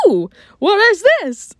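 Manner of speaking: high, loud "ooh" of discovery; intrigued surprise. Retold or imitated. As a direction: react to a thrilling little find. Acted delighted find of hidden box.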